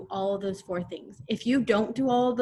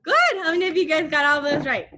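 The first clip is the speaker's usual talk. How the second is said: excited praise; 7 semitones higher, 6 dB louder than usual. Real, not acted. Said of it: "cheerful praise to class".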